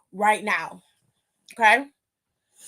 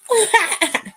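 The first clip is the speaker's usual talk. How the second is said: short high-pitched laugh, loud; high burst of laughter; 10 semitones higher, 6 dB louder than usual. Real, not acted. Streamer thanking chat, bursting into a high laugh.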